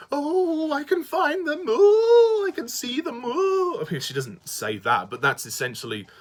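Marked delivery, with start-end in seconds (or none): 0.1-3.8 very high, wailing mystic; hammed-up fortune teller wail, swelling on each "Oh"; Seeker. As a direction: wail it out like a mock seer in a trance. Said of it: "in that sort of mannerism, that's what she does".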